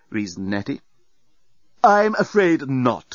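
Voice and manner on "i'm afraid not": slow, higher-pitched haughty refusal; sniffy. A narrator's reading. Doorbot refusing Nettie the way through, sniffy. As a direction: draw it out with disdain. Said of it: "sniffed the doorbot".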